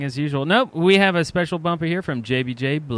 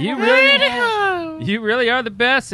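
gushing; 12 semitones higher, 3 dB louder, slower than usual. Acted. Reading fan message dramatically.

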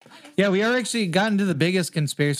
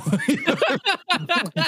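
laugh; 10 semitones higher than usual. Real, not acted. Laughing mid impression.

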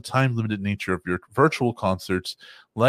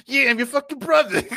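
high, loud hammed-up Brooklyn accent; cartoonish Brooklyn brother voice; mock Liquid accent; 14 semitones higher, 4 dB louder than usual. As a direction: play Liquid as a Brooklyn tough guy. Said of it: "Liquid has the same accent".

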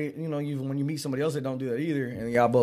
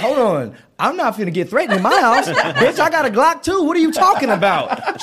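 hammed-up yell, much higher and louder, laughing; mock threatened shout; 11 semitones higher, 10 dB louder than usual. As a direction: fake tough-guy outburst about a Glock. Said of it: "I'm like".

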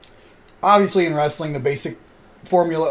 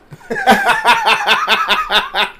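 loud, high cackle; high burst of laughter; 6 dB louder than usual. Real, not acted. Laughing hard after retelling the heel line. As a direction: crack up at your own story.

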